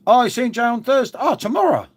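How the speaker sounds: high, loud oh of realization; real surprise at getting the day wrong; sudden realization. Real, not acted. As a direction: catch yourself mid-thought. Realizing the date mid-sentence.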